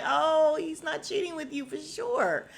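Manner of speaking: high, loud mock realization; mock relief. Wife seeing the publisher's heavy makeup, mock relieved. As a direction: burst out high in exaggerated relief.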